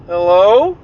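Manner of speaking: hesitant, rising hello; uneasy boy. Retold or imitated. Boy sits up in bed after a strange voice, tentative rising call. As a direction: imitate a boy calling into the unknown. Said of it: "He said".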